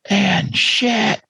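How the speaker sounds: high-pitched startled exclamation; retold alarmed cry. Wife finding blood at midnight, retold alarm. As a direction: call out in alarm, imitating.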